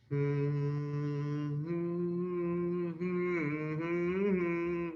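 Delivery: quiet droning hum; soft humming. Retold or imitated. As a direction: hum it softly like a machine.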